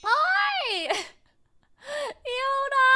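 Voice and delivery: very high squealed hello; high, giddy call-out; excited greeting. Real, not acted.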